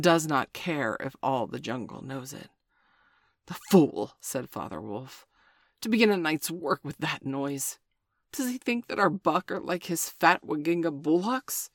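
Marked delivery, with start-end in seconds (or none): 3.4-4.1 loud, contemptuous; scornful outburst. Acted. Father Wolf scorns the noisy tiger, loud contempt. 5.8-11.7 scornful. Acted. Father Wolf scorns the tiger.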